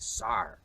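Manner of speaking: "sar" with open AR vowel and sounded R; Shakespearean pronunciation. Shown as a demonstration. Historian showing Shakespeare's sir, "sar" with open AR. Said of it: "Shakespeare would have said".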